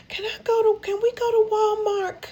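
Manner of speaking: put-on very high, pleading old-lady voice; high-pitched wistful request; imitated grandma.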